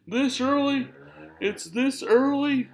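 high, slow, whiny put-on disbelief; a whiny complainer who can't believe it; mock complainer. Mocking a complainer with a high, whiny, slow voice. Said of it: "one of those people that was complaining".

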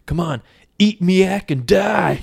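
slow, high, loud action-hero taunt; hammed-up heroic yell; battle cry. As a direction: draw it out as a triumphant threat. Hero's taunting battle cry, acted.

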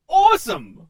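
loud thrilled cry; excited shout. Acted. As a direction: burst out with delight. Joseph thrilled by promotion.

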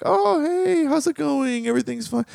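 high, bright mock greeting, everything's fine; hammed-up church-lobby cheerfulness; fake cheerful. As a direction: hide the fight behind sweetness. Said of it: "be like".

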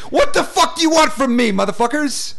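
very high, loud outburst at the critics; comic full-tilt rant, voice leaping up; exasperated shout. Real, not acted. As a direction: yell it out, fed up with complaints.

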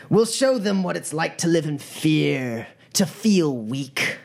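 rousing, theatrical villain speech; commanding gang leader. Acted. Gang leader addressing recruits about the corps, defiant oratory. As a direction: punch phrase openings high, then settle into measured menace.

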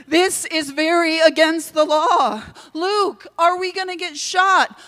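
high, loud alarm; panicked protest. Acted. Panic at trespassing on royal land.

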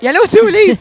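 blurting out the name she knows; excited echo. Real, not acted. Host recognizes the breeder her co-host named, excited echo.

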